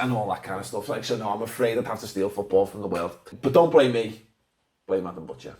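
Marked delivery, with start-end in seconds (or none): none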